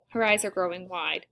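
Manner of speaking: ordinary delivery, nothing marked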